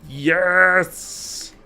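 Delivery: high, gleeful yes; excited cheer. Real, not acted. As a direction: cheer it out with glee. Cheering over fish news.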